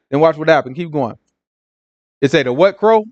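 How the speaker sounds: usual speaking voice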